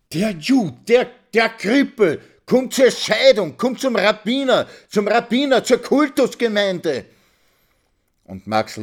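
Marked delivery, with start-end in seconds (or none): 0.1-7.1 high, loud shrieked accusation; screaming. Acted. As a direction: scream it through the streets. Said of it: "schrie sie durch die Gassen".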